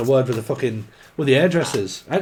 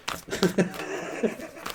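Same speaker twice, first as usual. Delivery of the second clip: breathy laugh; 7 semitones higher, 8 dB quieter than usual. Real, not acted. Laughing at her own tangent.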